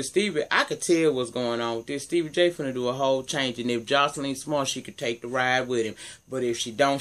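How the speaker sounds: ordinary talk, nothing marked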